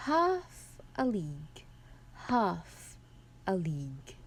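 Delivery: HALF a league, HALF a league, with "a league" falling away; stressed first syllable. Shown as a demonstration. Presenter reading Tennyson's dactyls, "Half a league" stressed on "half". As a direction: lean on each "half", pause, drop on "a league". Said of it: "which is the stressed one".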